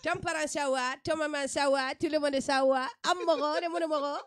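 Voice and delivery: loud, sing-song West African greeting; Malian greeting. Acted. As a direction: call out the greetings like in Bamako. Mimics Malian greeting ritual.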